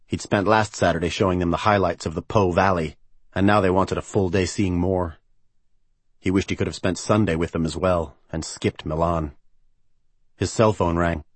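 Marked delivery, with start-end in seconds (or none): none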